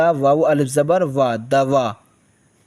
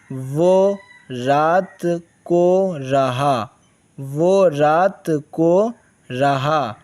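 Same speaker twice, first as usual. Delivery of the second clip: slow dictation; slower than usual. Shown as a demonstration. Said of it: "teacher reads slowly".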